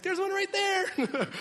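high, drawn-out gushing; excited baby talk. Retold or imitated. Dad spotting cows for his son, high slow excited squeal. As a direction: point it out thrilled to a toddler, voice way up. Dad pointing out cow to toddler.

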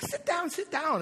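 put-on high little-kid voice; imitating his toddler. Toddler telling dad to join him, high imitated child voice. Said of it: "he's like".

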